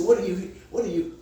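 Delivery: ordinary talk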